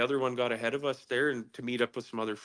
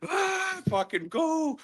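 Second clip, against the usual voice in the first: retold panicked cry; much slower than usual. Teller relives the slide breaking loose, panicked high cry. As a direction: imitate a panicked cry to flee.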